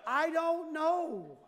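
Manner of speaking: comically exaggerated bafflement; exasperated husband. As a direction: draw it out, helpless and exasperated. Husband's exasperated acted answer.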